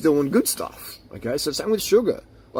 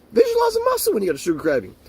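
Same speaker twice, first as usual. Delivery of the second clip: mocking repeat; 10 semitones higher, faster than usual.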